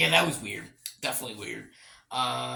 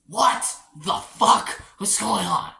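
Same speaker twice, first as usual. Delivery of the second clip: louder, slow, stretched-out outburst; fed up with the scene; exasperated yell; 5 semitones higher, 7 dB louder, slower than usual. Retold or imitated. Reviewer yells back at the family-like scene, slow and loud. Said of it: "I was just like".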